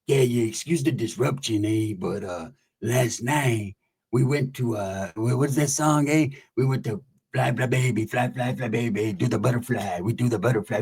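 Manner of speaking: lightly voiced low OG; high-power OG talk. OG recounting last night's song, low halting imitation. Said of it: "O Gs try to talk all high power".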